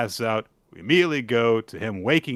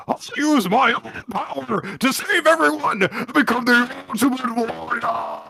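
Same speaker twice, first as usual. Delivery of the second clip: determined Gakuro; 12 semitones higher, 4 dB louder than usual. Acted. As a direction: swear it with trembling resolve. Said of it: "and he says".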